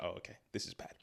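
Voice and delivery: breathy whispered dismay at the mirror; hushed dismay. Retold or imitated. In front of the mirror, realising he's fat, a whispered dismay. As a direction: whisper it like a grim discovery.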